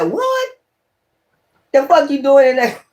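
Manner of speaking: a sudden high squeak of disbelief; shocked ancestor. Retold or imitated. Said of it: "Your ancestor said".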